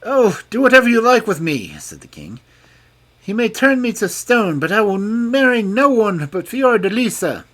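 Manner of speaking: resigned but stubborn; defiant king. A narrator's reading. King defies the fairy.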